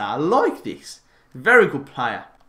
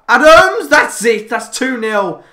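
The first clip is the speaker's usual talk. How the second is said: goal celebration; 7 semitones higher, 10 dB louder, slower than usual. Real, not acted.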